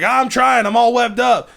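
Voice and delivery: high, whiny, exasperated; stuck Marine again. Retold or imitated. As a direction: plead from inside the webs. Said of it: "he's like".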